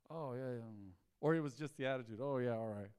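normal talking voice

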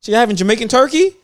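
high squeal of disbelief on what; incredulous shriek, then a baffled follow-up; shocked what. Retold or imitated. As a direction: act floored by the question. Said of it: "I'm like".

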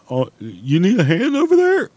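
high-pitched mock-helpful voice; mocking imitation. Fed-up worker mimicking the late helper, high and mocking. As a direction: pitch it way up and ridicule the offer.

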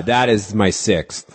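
ordinary talk, nothing marked

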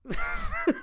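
high-pitched giggle; laughing. Real, not acted. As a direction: burst of high laughter mid-story. Cracks up at the story.

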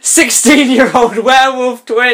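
high, stretched-out announcement; mock-dramatic reveal. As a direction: hype the ridiculous reveal, slowly and high.

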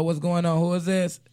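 ordinary talk

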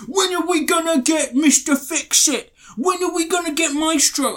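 whiny fanboy voice; mocking whiny fan. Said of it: "every year come out and say".

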